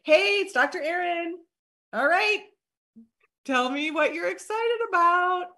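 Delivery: high, cheery greeting; lifted, chipper phone manner; perky follow-up call. Acted. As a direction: ask eagerly for their reaction. Demonstrating next-day follow-up greeting.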